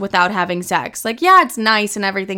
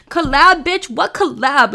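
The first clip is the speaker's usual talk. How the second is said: incredulous scoff; 5 semitones higher, slower than usual. Real, not acted. To the listeners, scoffing at the man's collab idea, incredulous. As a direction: throw the word back at him in disbelief. Outraged echo of his word.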